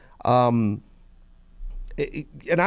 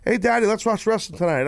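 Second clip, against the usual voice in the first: lightly voiced eager little kid, higher; 6 semitones higher than usual. Kid begging dad to watch wrestling, high childish voice. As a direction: ask Daddy like a small child.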